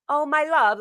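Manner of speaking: high-pitched, doting pet-name coo; endearing. Shown as a demonstration. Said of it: "my love is so dearing".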